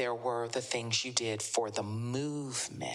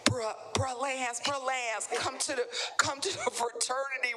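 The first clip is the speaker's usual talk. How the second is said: pestering frat recruiter; 9 semitones higher than usual. Retold or imitated. Voicing frat members recruiting him.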